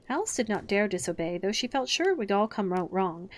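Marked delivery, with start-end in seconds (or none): none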